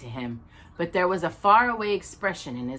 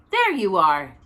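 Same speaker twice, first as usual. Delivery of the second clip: proud, a bit louder; proudly; 6 dB louder than usual. A narrator's reading. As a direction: present it with pride. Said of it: "he said proudly".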